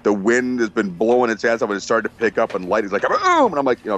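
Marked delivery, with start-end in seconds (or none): 3.0-3.5 sudden very high-pitched "boom"; imitated thunder crack. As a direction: burst out with the storm noise, pitched way up. Imitates thunder and lightning in story.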